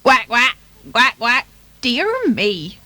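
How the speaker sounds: loud quacking duck voice; put-on duck squawk; Dorothy Duck. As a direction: quack it out, unimpressed. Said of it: "quacked Dorothy Duck".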